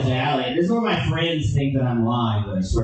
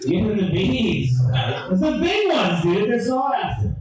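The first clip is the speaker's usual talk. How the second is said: high, pleading bouncer impression; a hammed-up bouncer begging; 6 semitones higher than usual. Bouncer voiced in story.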